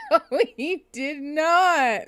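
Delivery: slow, high, laughing no; drawn out in laughing disbelief. Real, not acted. To the chat, disbelieving the man's wording, laughing slowly.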